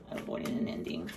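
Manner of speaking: breathy unvoiced whisper of the card title. Shown as a demonstration. Reader whispers the card name to herself. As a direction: breathe the words out with no voice.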